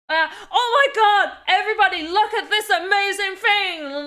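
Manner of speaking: over-the-top American hype, played for laughs; mock hype. Shown as a demonstration. Streamer imitates American hype to her viewers, mock excited. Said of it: "I couldn't even hype".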